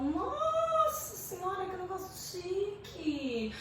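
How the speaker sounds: high, soft, drawn-out posh admiration; snooty high society. Acted. Teacher acting out awe at elitist toante rhyme, snooty and drawn-out. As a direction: gush slowly with your nose in the air. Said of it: "high society, nariz empinado".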